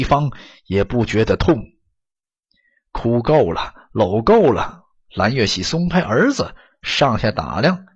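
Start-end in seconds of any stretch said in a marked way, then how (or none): none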